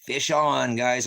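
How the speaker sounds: loud, high gloating call; yelling to viewers as he hooks a troll; excited shout. Real, not acted. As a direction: crow it like landing a big fish. Gloating over hooked troll.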